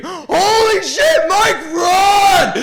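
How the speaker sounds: high, loud, stretched-out mock scream. Stung friend warning the storyteller about wasps, panicked scream. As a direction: scream a warning, dragging out each word. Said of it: "I hear him scream".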